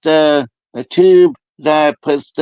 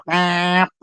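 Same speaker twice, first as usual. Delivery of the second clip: long, raised "bap" noise; imitated chicken squawk; 4 semitones higher, 3 dB quieter than usual. Answering the chickened-out joke with a chicken squawk. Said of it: "And she goes".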